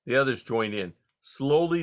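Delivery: ordinary delivery, nothing marked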